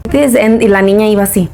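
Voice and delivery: ordinary talk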